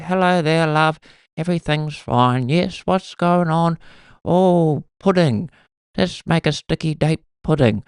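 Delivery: high-pitched sweet granny voice; old lady. Acted. Grandmother chatting on the phone about pudding, old lady voice. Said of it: "especially old ladies, they all just sound the same".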